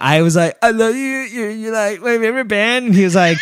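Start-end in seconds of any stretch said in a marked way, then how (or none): 0.6-2.9 high, quivering starstruck gush; quivering fanboy. Retold or imitated. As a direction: gush in a wobbly, overwhelmed high voice. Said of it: "the way you do that quivering voice".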